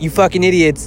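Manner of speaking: imitating a blunt mentor; mock scolding. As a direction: throw it at them like a frustrated mentor. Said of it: "He's like".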